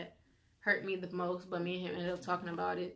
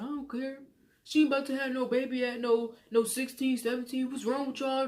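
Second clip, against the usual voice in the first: a hint of a dismissive, higher-voiced stepdad; lightly mocked stepdad; 5 semitones higher than usual. Stepdad refusing the teen pregnancy, mildly mocked as dismissive. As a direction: brush the whole thing off, mildly mocking.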